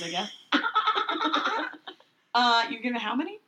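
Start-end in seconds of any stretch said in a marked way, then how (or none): 0.3-1.9 high squealing dolphin laugh; squeaky dolphin squeal; dolphin noise. Pretend. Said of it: "That's my dolphin noise".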